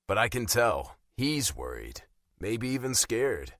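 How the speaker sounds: usual speaking voice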